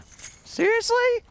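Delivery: drawn-out high incredulous seriously. Retold or imitated. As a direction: stretch it out high, unable to believe it. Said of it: "And I was just like".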